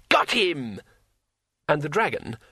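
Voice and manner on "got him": higher, exultant exclamation; delighted shout of victory; triumphant cry. A narrator's reading. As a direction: crow it in triumph. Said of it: "Two Flower laughed triumphantly".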